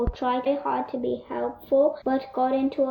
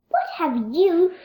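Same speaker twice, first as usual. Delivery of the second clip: higher, surprised exclamation; exclaiming in dismay; 6 semitones higher, 3 dB louder than usual. A narrator's reading. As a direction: catch them out, shocked. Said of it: "exclaimed Little Miss Christmas".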